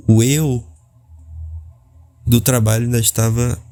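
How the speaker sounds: ordinary delivery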